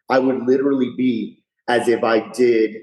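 ordinary talk, nothing marked

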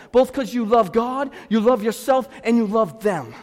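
loud, high preaching to the church; full force preaching. Acted. Pastor preaching hard to his congregation about drama.

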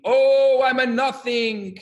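drawn-out, high sing-song wail; hammed-up chant held on one high note; mock wailing cry. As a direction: wail it long and high, imitating a student's lament. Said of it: "on either ends of the study hall screaming".

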